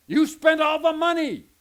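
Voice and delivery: loud, high accusing yell; wife blaming husband for spending, mock shout. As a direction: throw the blame at him loudly.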